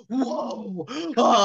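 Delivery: high, exaggerated gasping outrage; mock shock. Woman reacts to being insulted, mock shock.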